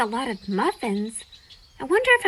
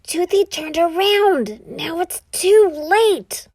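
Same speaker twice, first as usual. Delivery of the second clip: frustrated Toothy voice; 5 semitones higher, 6 dB louder, slower than usual. Acted. Toothy frustrated, missed the cat's try.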